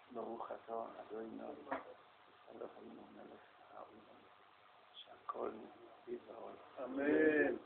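very soft, low real prayer aside; murmured blessing. Real, not acted. Speaker saying a blessing over a drink, murmured very quietly. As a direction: murmur it privately, with pauses between phrases.

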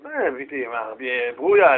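soft, low, mild remonstrance; quiet, deep address to a spouse; rabbi to his wife. Acted.

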